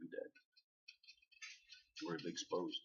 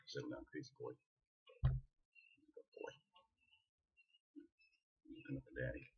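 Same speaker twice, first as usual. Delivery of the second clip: whispered to calm a dog; breathy calming whisper; soft hush; 10 dB quieter than usual. Real, not acted. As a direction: hush him down gently. Hushing the dog.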